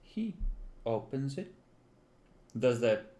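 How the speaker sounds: usual speaking voice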